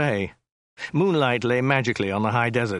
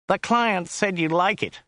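high, slow whiny driver; Joe's whiny voice; 8 semitones higher than usual. A narrator's reading. Driver to his passenger about the car, high and whiny. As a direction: put on a high whine. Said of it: "His voice was rather high and whiny".

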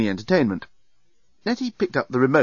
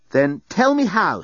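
firm demand; 8 semitones higher, 4 dB louder, slower than usual. Acted. Nettie demands.

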